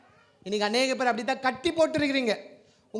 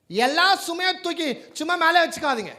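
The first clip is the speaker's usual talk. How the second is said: normal talking voice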